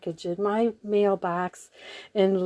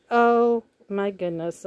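normal talking voice

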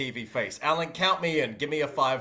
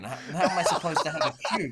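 ordinary talk

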